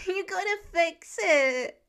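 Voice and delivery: put-on high, slow, pleading little boy; distraught child. A narrator's reading. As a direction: beg Dad to fix it, near tears. Distraught child begging father.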